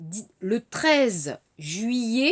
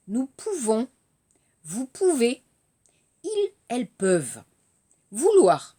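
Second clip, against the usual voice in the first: conjugation drill. Shown as a demonstration. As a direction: read each verb form out for students to repeat. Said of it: "écoutez d'abord les verbes".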